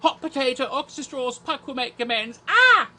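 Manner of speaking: high, rapid-fire chant ending in a loud yelp; Blackadder actors' superstition ritual. Acted. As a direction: rattle off the superstitious ritual, then cry out. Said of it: "that thing from Blackadder".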